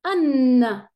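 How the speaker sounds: an said loud and held as a model; nasal an sound. Shown as a demonstration. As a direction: say an clearly, like a teacher's model. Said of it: "Donc on va dire".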